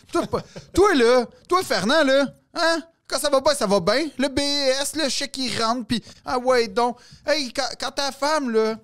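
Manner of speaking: high-pitched monologue impression of a phone tirade; mock telling-off over the phone; acted brasserie rant. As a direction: rattle off his grievances, pitched well up. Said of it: "quand il reçoit l'appel à la brasserie".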